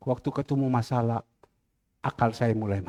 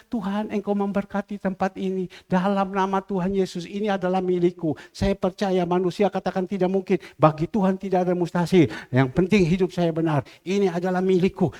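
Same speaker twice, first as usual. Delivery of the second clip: ordinary delivery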